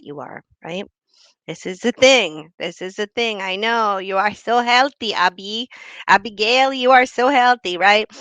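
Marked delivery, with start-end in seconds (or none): 1.5-8.1 toxic aunt. Retold or imitated. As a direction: play the aunt needling her niece about weight. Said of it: "The toxic tita".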